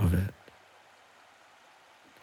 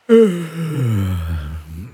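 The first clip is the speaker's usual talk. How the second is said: long audible sigh. Shown as a demonstration. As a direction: let out a big slow sigh. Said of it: "Have a big yawn".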